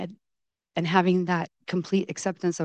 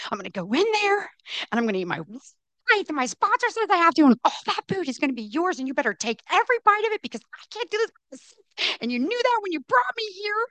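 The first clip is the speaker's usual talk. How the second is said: high-pitched, fast, strained retold yell; climbing to a frantic pitch; yelling at God; 11 semitones higher, faster than usual. In the car before the buffet, yelling at God, frantic and high. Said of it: "I yelled at God again".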